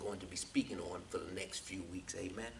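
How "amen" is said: ordinary talk, nothing marked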